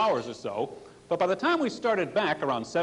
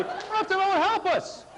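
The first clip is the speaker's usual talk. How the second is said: panicked cry for help; 13 semitones higher than usual. Retold or imitated. Renewed panicked cries.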